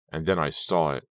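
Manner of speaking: plain reading voice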